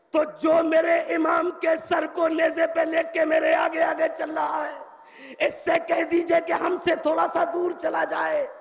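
high, ringing oratorical cry; impassioned plea. Acted. Zainab begs Sahl to have the head moved away, high loud plea. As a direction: plead loudly on one sustained high pitch.

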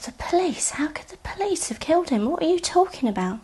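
high-pitched incredulous questioning; stunned disbelief, high but not shouted; retold disbelief. Retelling her shock at the news.

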